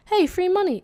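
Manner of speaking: high, loud happy exclamation; naive delight. Retold or imitated. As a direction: mimic a kid thrilled by free cash. Retells own naive delight.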